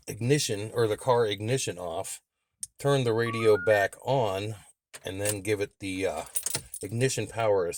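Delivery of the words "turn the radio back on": ordinary talk, nothing marked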